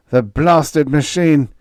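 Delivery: slow, loud, high-pitched exasperated curse; angry curse. A narrator's reading. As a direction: spit out the curse at the machine. Startled steward curses robot.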